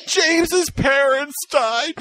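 high, slow mock sob; imitating her friend crying about the news, slowly. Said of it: "Jen was, Jen was crying".

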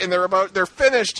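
usual speaking voice

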